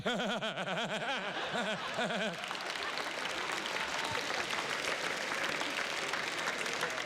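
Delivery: long real laugh; helpless laughter at own story. Real, not acted. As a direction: laugh it out freely.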